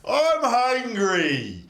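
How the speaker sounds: very slow, loud, high whine; dumb giant head. Acted. GM voices the dumb head whining about food. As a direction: moan it out slowly like a hungry oaf.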